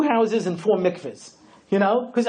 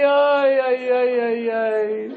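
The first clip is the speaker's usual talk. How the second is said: ay ay ay lament; 3 semitones higher, much slower than usual. Real, not acted. Laments the painful truth.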